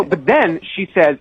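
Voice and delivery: ordinary talk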